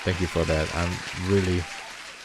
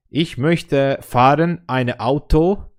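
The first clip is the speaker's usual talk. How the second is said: slow, higher, self-mocking broken German; 7 semitones higher, 4 dB louder, slower than usual. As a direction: put on clumsy beginner German, word by word. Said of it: "you should hear me when I speak German".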